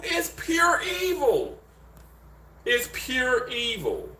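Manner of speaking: very high, drawn-out squeal that falls at the end; exaggerated exclamation. Real, not acted. Host reacting to the evil woman, high drawn-out exclaiming. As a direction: stretch it out way up high.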